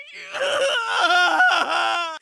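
wavering scream of agony; long, tormented wail; scream of pain. Acted. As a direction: cry out as the pain hits. Patient screams under painful treatment.

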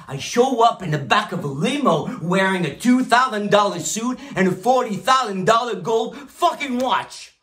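high, loud, hammered-out brag; emphatic boast. Acted. Rich boss brags of limo and watch, emphatic boast.

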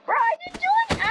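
high-pitched protest; squeaky high voice. Acted. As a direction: squeak the denial.

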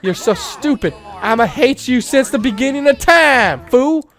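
hammed-up shouted insult; mock yell. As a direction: yell it up at the nemesis. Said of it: "We're gonna yell at Dante".

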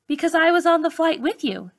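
a hint of a kind doctor sharing a surprise; doctor's voice. A narrator's reading. Doctor answers the girl.

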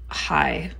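ordinary delivery

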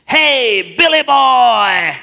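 loud, slow raspy call; raspy old man voice. Retold or imitated. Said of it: "in that raspy voice that I remember".

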